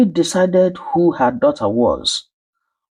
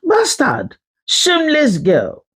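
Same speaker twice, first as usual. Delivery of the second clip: much higher pitched, cutting insult; angry insult; 11 semitones higher than usual. Acted. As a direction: spit the words out at a child. Abusive adult yelling at child.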